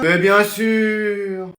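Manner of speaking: very slow, stretched-out words; drawling fake consent; sarcastic agreement. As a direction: drag it out as mock agreement. Parents answer absurd request.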